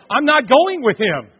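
loud, high, slow refusal; frightened refusal. Retold or imitated. Lady refusing to follow the grim reaper, loud slow. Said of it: "this lady's going".

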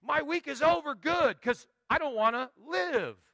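imitating a purposeless person, loud and bitter; mock despair. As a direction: sneer out the week's misery. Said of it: "people who exist with no purpose".

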